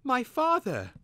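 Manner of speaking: high, loud burst of surprise; excited exclaim. A narrator's reading. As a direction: blurt it out, unable to hold back. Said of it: "Twig exclaimed".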